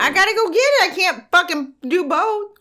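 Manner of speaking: high-pitched exasperated outburst; real flash of irritation, pitched high; aggressive. Real, not acted. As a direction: snap back, voice jumping up. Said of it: "Oh, I am aggressive".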